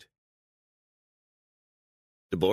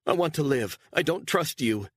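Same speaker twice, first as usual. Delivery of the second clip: higher, louder, on the edge of tears; tearful plea; 11 semitones higher, 4 dB louder than usual. Acted. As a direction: plead through tears, gritting her teeth. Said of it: "tears in her eyes".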